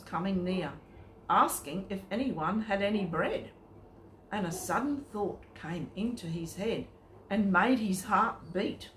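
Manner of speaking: ordinary delivery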